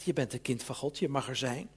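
soft and low, lovingly reassuring; tender voice of God. Acted. Preacher voicing God tenderly to the congregation, soft and low. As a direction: speak it like a father comforting his child. God's voice comforting a child.

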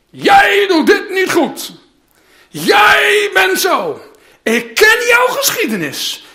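imagined angry God scolding his people; harsh accusing God. Acted. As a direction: rail at them like a stern judge.

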